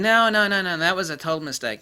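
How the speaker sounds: loud high no no no; mock denial. Bob fan denying the arrest in a loud mock panic. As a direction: deny it in a panic.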